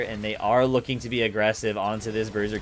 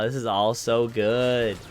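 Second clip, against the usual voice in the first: normal talking voice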